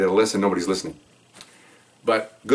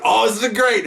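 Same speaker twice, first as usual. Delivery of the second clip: delighted boss; 12 semitones higher, slower than usual. Retold or imitated. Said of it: "He goes".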